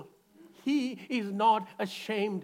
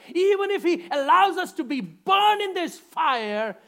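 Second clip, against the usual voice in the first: loud, higher, triumphant; bold proclamation; 8 semitones higher, 7 dB louder than usual. Retold or imitated. Three men boldly telling the king their God can save. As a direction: declare it to the king with fire. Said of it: "these men said to Nebuchadnezzar".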